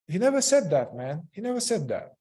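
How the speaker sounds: high, stressed denial about father; emphatic. Real, not acted. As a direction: insist hard he never said it.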